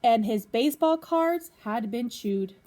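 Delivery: lively storybook reading to a class; reading to children. Acted. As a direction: read it out like a teacher at story time. Teacher reads picture book to class.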